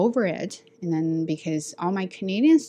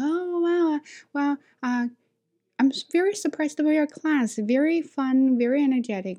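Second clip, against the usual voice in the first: high-pitched amazed praise; surprised; 8 semitones higher than usual. Retold or imitated. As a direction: imitate a boss taken aback, pitched well up. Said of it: "he was surprised".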